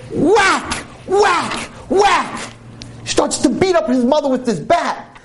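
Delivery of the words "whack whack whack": whack sound. Retold or imitated. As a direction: shout the bat's impact. Repeated bat blows.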